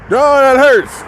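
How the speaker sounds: high-pitched mock cry of pain; imitated scream. Mother protests being rolled over, imitated high scream. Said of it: "She be screaming at".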